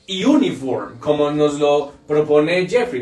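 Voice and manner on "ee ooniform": "uniform" as "ee-oo-nee-form"; wrong ee-oo onset. Shown as a demonstration. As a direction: split the start of "uniform" into vowels ee-oo. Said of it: "ni se dice uniform".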